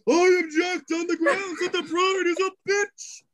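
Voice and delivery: Arnak objecting. Acted. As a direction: squeal it like a cheeky paladin. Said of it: "As he goes".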